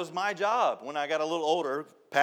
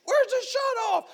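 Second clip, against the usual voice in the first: high, slow panicked cry; mock panic; 6 dB louder, slower than usual. Workers at a busted pipe, high slow panic. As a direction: cry it out high like everyone's lost.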